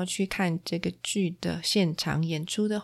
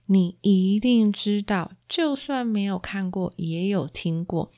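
ordinary talk, nothing marked

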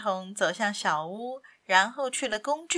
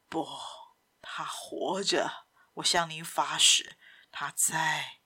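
pleading Simon; 5 semitones lower than usual. Acted. Simon assuring the captain she lives, low and hushed.

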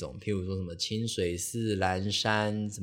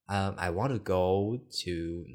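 hesitant halting English; much slower than usual. Retold or imitated. Stuck on the English name.

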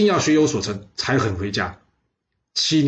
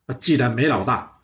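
ordinary delivery

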